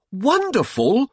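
high, loud, incredulous echo; spat disbelief. A narrator's reading. Sadie repeating her husband's praise, spat in disbelief. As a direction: throw the word back in outrage. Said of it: "spat Sadie".

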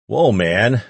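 plain reading voice

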